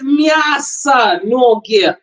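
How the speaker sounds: spells out мясо ноги slowly and patiently for a learner; each syllable of Мясо ноги drawn out, patient; slow, patient repeat. Retold or imitated. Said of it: "She said".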